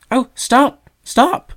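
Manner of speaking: high, loud pleading cry; distressed cry. A narrator's reading. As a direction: cry out in distress to stop him. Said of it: "cried the young bird".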